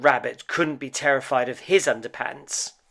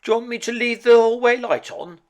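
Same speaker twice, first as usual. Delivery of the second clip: dad; 12 semitones higher, 10 dB louder, faster than usual. Acted. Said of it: "asked Dad".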